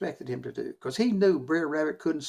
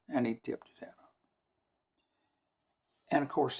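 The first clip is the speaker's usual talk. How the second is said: ordinary delivery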